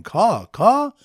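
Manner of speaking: high, loud bird call; yelled caw. Retold or imitated. Bird watchers yelling at the birds, high loud caw. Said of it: "Bird watchers yelling".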